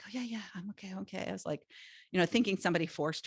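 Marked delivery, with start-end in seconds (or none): 0.0-1.2 soft unvoiced reassurance; whispered okay. Tearful woman on the zip line, whispering she's fine. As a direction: breathe it out, brushing off worry. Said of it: "And she's like".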